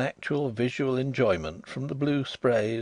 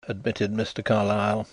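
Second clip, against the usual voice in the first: plain reading voice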